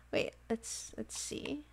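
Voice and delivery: hushed whisper, let's see; whispering to herself. Real, not acted. Streamer checking her game quietly, a whisper. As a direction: whisper it under your breath.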